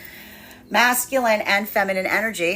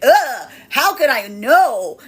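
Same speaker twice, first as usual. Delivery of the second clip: slow, louder, dragged-out "Ugh"; mock disgusted groan; 4 semitones higher, 6 dB louder, much slower than usual. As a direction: cringe at your past self. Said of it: "the energy being like".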